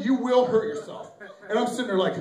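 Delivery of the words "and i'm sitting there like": normal talking voice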